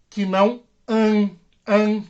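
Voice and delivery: a strongly nasalized "an", pushed up into the nose; nasal an. Shown as a demonstration. Teacher showing the class the nasal "an". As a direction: say "an" almost through the nose. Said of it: "Tem que sair aqui quase no nariz".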